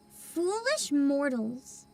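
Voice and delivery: slow, ominous villain voice; dark lord. Pretend. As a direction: drag it out like a spooky host. Spooky game intro line.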